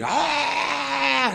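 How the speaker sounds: long high-pitched "aah" yell; imitated yell. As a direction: mimic a fighter's drawn-out scream. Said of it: "Khabib's yelling".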